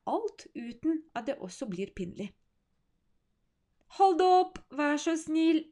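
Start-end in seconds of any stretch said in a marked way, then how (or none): 4.0-5.6 pleading. Acted. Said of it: "mumler Alice".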